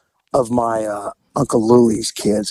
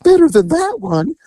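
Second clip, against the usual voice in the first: high, prim voice of the mom; a nervous mother hoping it gets better; lightly mocking mother's fretful tone; mom's worried hope; 14 semitones higher than usual. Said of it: "my mom goes".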